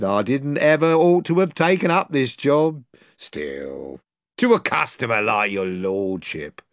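butter up the customer; fawning slaver. Acted. Pug flattering the lord to sell Caspian, fawning.

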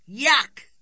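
high, loud exclamation of disgust; disgusted boys. Acted. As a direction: blurt it out like a revolted child. Brothers disgusted by pink pony.

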